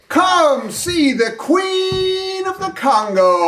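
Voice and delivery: drawn-out carnival cry to the crowd; barker's call. Acted. Showman hollering at passing customers, drawn out.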